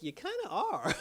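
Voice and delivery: amused disbelief, laughing. Real, not acted. Laughing retort at Penguin's claim.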